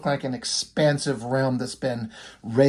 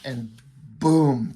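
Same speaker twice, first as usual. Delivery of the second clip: ordinary delivery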